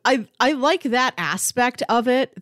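ordinary talk

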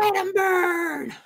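high, slow, drawn-out screaming; villain shout. Retold or imitated. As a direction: roar it like a mad villain. Quoting fiery trailer line.

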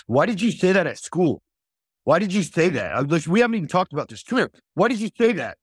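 imitating a dad's high-pitched scolding; aggressive. Dad confronting his son about school, aggressive. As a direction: act out a father laying into his kid, pitch jumping up. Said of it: "All aggressive at his son".

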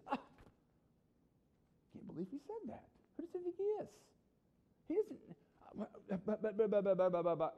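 high-pitched, affronted sputtering; defensive reaction. Acted. Accused Pharisee reacts to Jesus, defensive and flustered. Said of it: "this is how you react when somebody accuses you".

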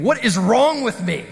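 slow, drawn-out exasperated question; appalled self-reproach. Retold or imitated. Pastor retelling his thought as he threw a bat at his friend, appalled. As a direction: lean on it, dismayed at yourself.

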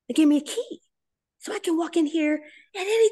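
delighted disbelief at "They gave me a key"; awed excitement. Real, not acted. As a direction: say "They gave me a key" again, high with wonder.

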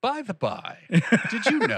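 high-pitched, mock-casual announcement; put-on high 'oh, incidentally' voice; mock belated disclosure. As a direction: slip it in high, as a hammed-up afterthought. Mocking staff's late disclosure.

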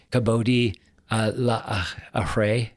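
"Kavodi, la ahare" in Hebrew, with a pause at each word; Hebrew pronunciation. Shown as a demonstration. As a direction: sound out "Kavodi" then "la" then "ahare" carefully. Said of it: "Can you pronounce it to us in Hebrew".

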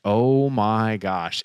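very slow, drawn-out disbelief; slow-motion awe; drawn-out stunned reaction. Retold or imitated. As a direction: stretch each word in mock shock. Mimics reaction to a shocking death.

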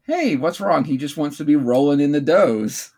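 normal talking voice

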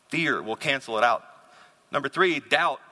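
ordinary talk, nothing marked

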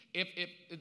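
ordinary talk, nothing marked

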